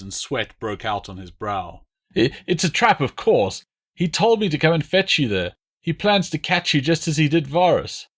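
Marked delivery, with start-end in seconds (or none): 2.2-8.1 high, frightened begging prisoner voice; pleading deserter. A narrator's reading. Prisoner begging the Roman commanders, high and frightened. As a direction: beg for your life, voice pitched up in fear.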